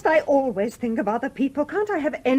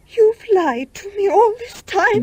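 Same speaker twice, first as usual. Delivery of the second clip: hurt accusation; 7 semitones higher, 9 dB louder, slower than usual. Acted. Accusing lover, betrayed.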